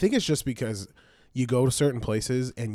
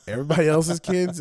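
normal talking voice

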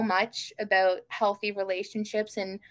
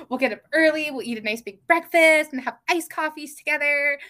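higher, louder eager gush with a gasp first; retold bubbling enthusiasm, pitched up; all excited; 5 semitones higher, 5 dB louder than usual. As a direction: imitate an excited partner rattling off happy plans. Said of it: "He got all excited. He was like".

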